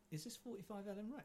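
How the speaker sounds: high, soft rising question; lightly voiced courier checking an address; courier at the door. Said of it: "he goes".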